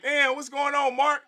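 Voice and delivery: high, bright mock greeting; mock friendly greeting. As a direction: greet him like an old buddy.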